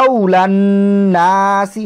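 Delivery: আউলান নাসি chanted, n held long; ghunnah recitation. Shown as a demonstration. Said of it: "এখানেও একটা গুণনা হয়েছে".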